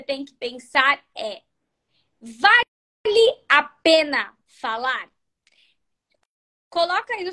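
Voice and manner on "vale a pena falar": very slow, loud, word by word; emphatic dictation. Shown as a demonstration. Teacher dictates the first question for notebooks, slow emphatic dictation. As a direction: spell out the key question slowly and forcefully.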